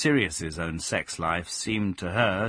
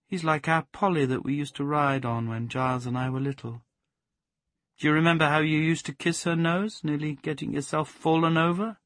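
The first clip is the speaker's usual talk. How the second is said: put-on higher wistful young woman; lightly voiced Plaxi; 7 semitones higher than usual. Plaxi reminding the dog of their childhood pony. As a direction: remember it fondly.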